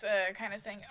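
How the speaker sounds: ordinary talk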